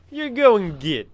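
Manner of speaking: a hammed-up American twang; terrible fake American accent; bad American accent. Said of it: "worst American accent I've ever done".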